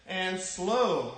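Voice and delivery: drawn-out "slow". Shown as a demonstration. Coach cueing kids to punch in slow motion, slow. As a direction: stretch "slow" with a falling tune. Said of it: "When I say slow, you're slowing it down".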